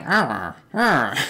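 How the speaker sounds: funny falling villager grunts; imitated villager noise. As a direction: mimic a game villager's wordless "hrrr" twice. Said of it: "I like the noises they make".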